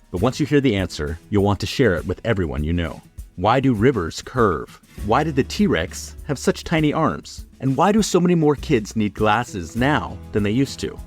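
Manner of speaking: usual speaking voice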